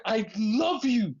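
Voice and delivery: high, drawn-out imitated declaration; mock anguished plea. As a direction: stretch it out like a melodramatic plea. Retelling wrestler's anguished confession.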